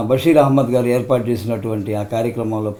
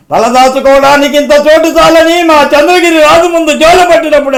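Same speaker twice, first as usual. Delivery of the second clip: very high, roaring challenge; full-throated rebel tirade, words held at the top; Krishna as Alluri Sitarama Raju; 14 semitones higher, 9 dB louder than usual. Acted. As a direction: fling scornful questions like a thundering film hero. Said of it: "Krishna గారు నటించారు climax సన్నివేశం".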